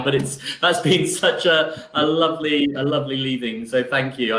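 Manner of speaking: high, breaking, tearful voice; blubbering through the thanks; crying. Real, not acted. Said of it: "I'm sorry I'm crying now".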